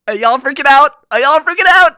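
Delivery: high, loud hyped-up yell; excited shout. Real, not acted. Asking viewers if they're freaking out, high excited yell.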